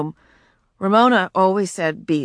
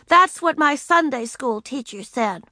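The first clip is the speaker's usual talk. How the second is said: put-on little girl voice; 7 semitones higher than usual. A narrator's reading. Ramona voiced in story.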